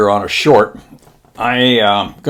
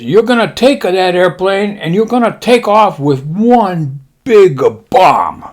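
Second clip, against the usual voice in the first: high, drawn-out mock-Japanese admiral; 9 semitones higher, 4 dB louder than usual. Admiral briefing pilots on mission, high mock-Japanese proclamation. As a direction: proclaim it in a put-on Japanese accent. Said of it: "He says".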